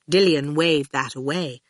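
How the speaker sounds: ordinary delivery, nothing marked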